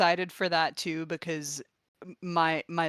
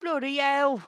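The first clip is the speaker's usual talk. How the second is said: higher, hammed-up Cockney voice; 7 semitones higher than usual. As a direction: play a cheeky Cockney lad.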